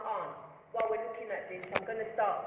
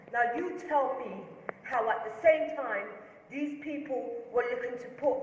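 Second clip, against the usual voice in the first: ordinary talk, nothing marked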